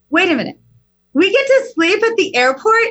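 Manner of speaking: put-on high, thrilled child voice; daughter's delighted disbelief. Twelve-year-old daughter hearing they'll sleep at the airport, high and thrilled. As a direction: squeal it like a kid who can't believe her luck. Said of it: "she says".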